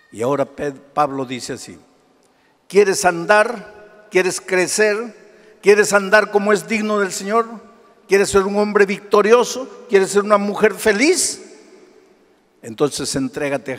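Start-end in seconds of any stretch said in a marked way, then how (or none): 2.7-11.4 slow, louder rhetorical questions driven at the congregation; fiery preaching. Acted. Pastor challenging his congregation with slow, louder preaching. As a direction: press each question home from the pulpit.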